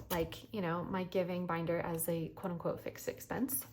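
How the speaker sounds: ordinary talk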